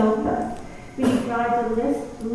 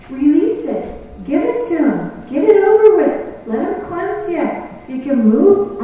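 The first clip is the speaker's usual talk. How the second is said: building louder and higher to a push; urging; 4 semitones higher, 5 dB louder than usual. Real, not acted. Preacher urging women to release guilt, building louder. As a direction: press them to let go, rising. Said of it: "urging the listeners".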